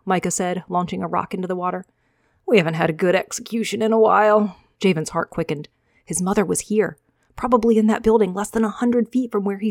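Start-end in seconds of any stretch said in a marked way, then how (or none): 2.5-4.6 louder, relishing a cruel thought; cruel soldier. Acted. Soldier to his cohorts, louder and gloating. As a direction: savor the idea of an execution.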